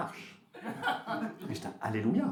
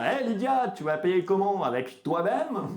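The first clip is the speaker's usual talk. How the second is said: mocking jerk voice; 7 semitones higher, 5 dB louder, slower than usual. Mocking joke voiced by harassers.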